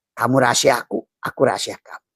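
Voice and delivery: ordinary talk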